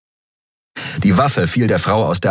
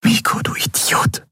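whisper. A narrator's reading. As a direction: whisper it over his still body. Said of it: "flüsterte sie".

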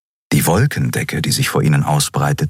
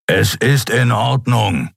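rumbling reassurance; 5 semitones higher, slower than usual. A narrator's reading. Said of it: "versicherte er grollend".

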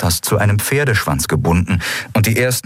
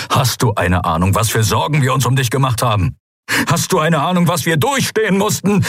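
building from tense reproach to high-pitched outrage; angry barking; 6 semitones higher than usual. A narrator's reading. Father reproaches daughter for the worry, building to a bark. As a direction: scold with mounting fury. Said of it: "bellte er".

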